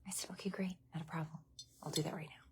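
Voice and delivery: whispered, fading into a hush; whispered retelling while someone sleeps. Real, not acted. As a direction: whisper it so as not to wake anyone. Whispering on the recording.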